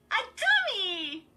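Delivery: high, loud, drawn-out shriek. A narrator's reading. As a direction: shriek it with glee. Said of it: "she shrieked".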